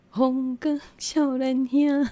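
put-on slow, high, puffed-up young lad; proud young man. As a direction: stretch it out like a cocky youth. Dad thinks he's young. (拖长、高调、神气的少年郎)

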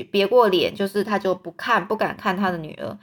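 ordinary delivery, nothing marked

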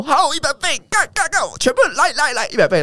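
put-on a boy carried away, yelling "all in"; hyped-up gambler shouting. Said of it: "他说". (很嗨的高音起哄喊)

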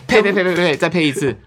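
laughing mock spitting. Ritual spitting away bad luck.

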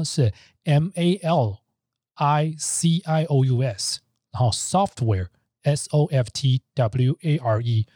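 slow letter-by-letter spelling. Shown as a demonstration. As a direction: spell each letter slowly. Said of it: "那怎么拼呢".

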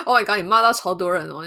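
laughing while teasing. Real, not acted. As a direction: tease between laughs.

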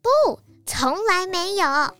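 declaring. A narrator's reading. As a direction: announce it firmly, stretching each word. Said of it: "小松鼠宣布". (一字一顿地宣布)

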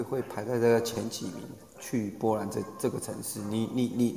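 ordinary delivery

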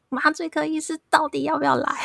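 high mock yell, breaking into laughter; husband yelling down the hall for the anesthetist, laughing; retold yell. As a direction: shout it down the hall, laughing as you go. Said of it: "真的大吼说".

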